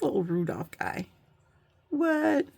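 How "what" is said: high delighted squeak; surprised. Real, not acted. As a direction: react with cute surprise. Delighted at cute ornament.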